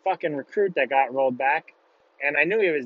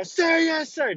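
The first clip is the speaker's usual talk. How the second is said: very high recruit yell; screaming reply; 13 semitones higher, slower than usual. Retold or imitated. Recruit keeps screaming to get caught, high yell. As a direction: yell it, stubbornly drowning him out. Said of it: "just screaming as loud as I can".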